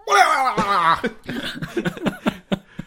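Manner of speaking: loud high laughing fit; big real laugh; burst of laughter. Real, not acted. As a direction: crack up hard. Laughing at imagined scene.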